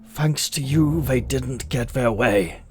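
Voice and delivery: put-on Sakaran accent, captive cursing his torturers. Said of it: "he says in a Sakaran accent".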